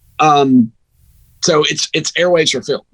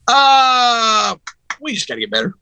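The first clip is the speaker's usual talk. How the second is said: sarcastic coach; 7 semitones higher than usual. Retold or imitated. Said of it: "He's being sarcastic".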